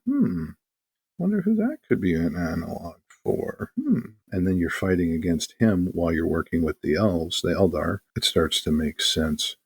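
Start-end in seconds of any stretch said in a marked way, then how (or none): 0.1-4.0 sarcastic hmm. Retold or imitated. Sarcastic aside about the inquisitor.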